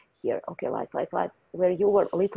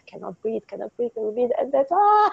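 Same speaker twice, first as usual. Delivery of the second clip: gasping for breath; 5 semitones higher than usual. Acted. Healer voicing suffocation.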